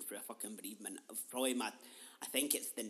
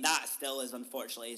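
ordinary delivery

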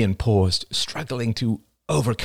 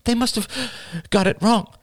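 put-on young woman voice. A narrator's reading. Rachel protests.

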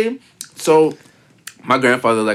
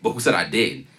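deadpan snub; 5 semitones lower, 5 dB quieter than usual. Retold or imitated. Voicing rude TikTok persona.

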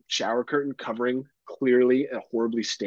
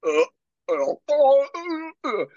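high strained retching groans, mimicked; mock the guy heaving his guts out; imitating retching noises; 4 dB louder, much slower than usual. Roommate imitating a stranger's violent vomiting groans. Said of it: "the noises and the splashing".

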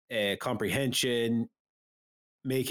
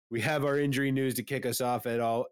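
ordinary delivery

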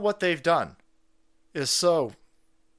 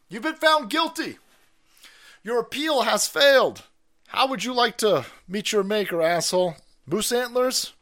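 mock game-show executioner; 6 semitones higher, 5 dB louder than usual. Mock executioner offers a menu.

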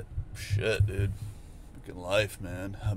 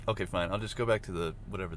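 ordinary talk, nothing marked

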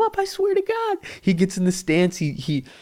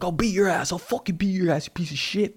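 angry; 7 semitones higher, 3 dB quieter than usual. Retold or imitated. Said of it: "He's just like".

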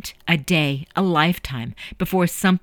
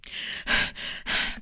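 long unvoiced exasperated sigh; impatient sigh; 10 dB quieter than usual. A narrator's reading. Boy stuck in a slow ticket queue, impatient sigh. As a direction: huff out air, waiting in line.

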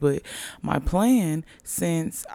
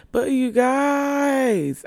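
drawn-out greeting; 9 semitones higher, 4 dB louder, much slower than usual. Real, not acted. Stretched greeting to listeners.

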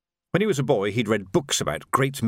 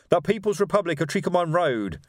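higher-pitched proud declaration; 7 semitones higher than usual. A narrator's reading. Revolutionary announcing the new republic to sergeant, proud. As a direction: announce it with a touch of pride. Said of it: "said Reg proudly".

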